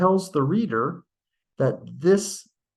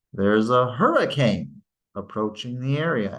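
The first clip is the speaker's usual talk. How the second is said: teacher reading aloud, stressing "hurricane" with higher pitch; 2 semitones lower than usual. Shown as a demonstration. Grammar teacher demonstrating end focus, "hurricane" raised. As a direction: raise the pitch on "hurricane" and let the rest fall. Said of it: "hurricane gets that higher pitch".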